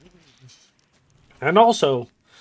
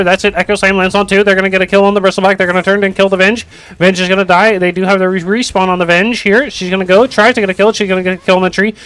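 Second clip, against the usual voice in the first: fast, louder esports caster call; live play-by-play; 4 dB louder than usual. Acted. Streamer casts the Dota teamfight for chat, fast and loud.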